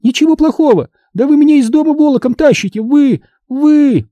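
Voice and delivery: shrieky indignant protest, spluttering at the end; outraged squeal. A narrator's reading. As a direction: rattle it out high and scandalised. Minди goes on squealing in outrage.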